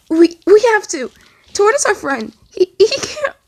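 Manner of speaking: desperate tearful outburst, stammering; shaking, breaking voice. A narrator's reading. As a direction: force the words out while the voice breaks. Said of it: "His voice shook and broke".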